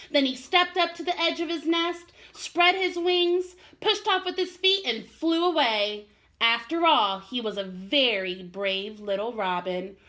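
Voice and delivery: put-on gentle storybook narration for kids; reading to children. A narrator's reading. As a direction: read it like a bedtime story for little ones. Teacher reads a children's story aloud.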